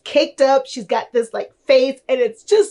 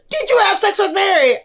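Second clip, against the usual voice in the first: even higher mock mom pressing the question; insistent prying mom; 9 semitones higher than usual. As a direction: push harder, like a mother who won't drop it. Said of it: "And he's like".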